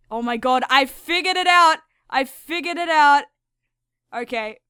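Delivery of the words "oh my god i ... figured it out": loud, high eureka; shouting a discovery; triumphant shout. Real, not acted. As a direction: shout it like you cracked it.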